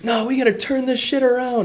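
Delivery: high, riled-up yelling; mock furious yell; angry shout. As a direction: rant like a fired-up caller. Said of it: "he basically was screaming at me".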